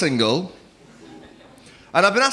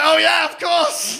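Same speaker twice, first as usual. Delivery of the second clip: mock enthusiasm; 13 semitones higher, 5 dB louder, slower than usual. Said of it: "I was like".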